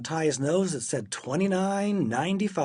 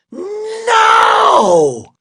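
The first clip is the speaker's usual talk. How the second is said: huge, high, long-held shout; explosive drawn-out bellow; all-out yell; 16 dB louder than usual. A narrator's reading. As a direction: wind up and scream the refusal. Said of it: "all yelled".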